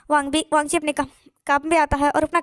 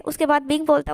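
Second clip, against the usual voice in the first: ordinary delivery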